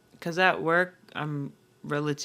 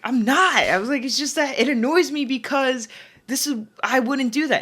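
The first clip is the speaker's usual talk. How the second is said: defensive protest; 9 semitones higher, 6 dB louder than usual. Retold or imitated. Defensive retort to older coworker.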